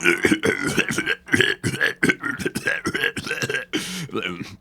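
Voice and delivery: long hacking cough after a bong hit; acted coughing fit. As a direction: cough on and on as if choking on smoke. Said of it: "took a hit out of it".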